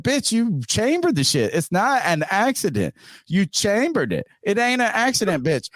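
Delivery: high-pitched, emphatic telling-off; indignant retold scolding. Man retelling how he rejected his friend's excuse, indignant. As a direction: replay the argument, pitched high and insistent. Retold argument over the accident.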